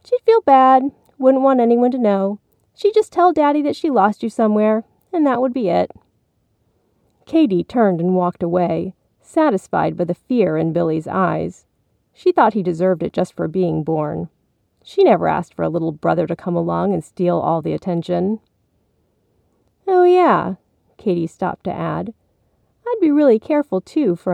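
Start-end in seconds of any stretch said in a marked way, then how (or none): 0.0-5.9 put-on higher little-girl voice for the sister; taunting big sister. A narrator's reading. Katie scaring her little brother, taunting him. 19.9-20.6 high, loud sisterly afterthought; taunting big sister. A narrator's reading. Katie stops to add a warning, taunting.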